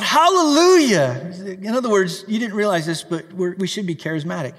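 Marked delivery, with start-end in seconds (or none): 0.0-1.3 heavenly cry. Acted. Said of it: "Once more they cried out".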